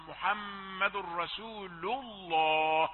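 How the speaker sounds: الله with a full thick lam, slow; heavy lam in Allah. Shown as a demonstration. Teacher gives example of the heavy lam. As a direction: draw out محمد رسول الله. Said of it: "سبق لم لفظ الجلالة ضم".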